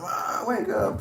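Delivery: high-pitched imitated kid whine; pestering little sister. Little sister nagging sleeping brother to wake up, high excited pestering. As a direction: plead like an excited child.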